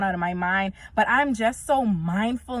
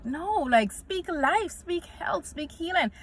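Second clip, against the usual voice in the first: high, pleading urge, fading softer; urging; 7 semitones higher, 4 dB quieter, slower than usual. Retold or imitated. Correcting her sick mom, high and softening. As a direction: coax her mom to speak life, higher and hushed. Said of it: "I was like".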